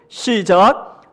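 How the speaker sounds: ordinary delivery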